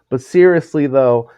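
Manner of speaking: high, loud mock-serious impression. Imitating a celebrity addressing his camera, mock-serious high voice. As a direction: raise it into a mock-earnest announcement. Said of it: "And then he goes".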